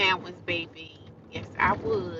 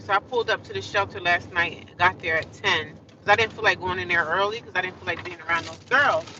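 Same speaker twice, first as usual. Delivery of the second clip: ordinary talk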